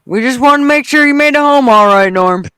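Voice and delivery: a loud, high-pitched parody of a polite cop; hammed-up friendly cop. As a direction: play the cop sweetly calling after Norm. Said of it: "The cops used to follow my father-in-law home".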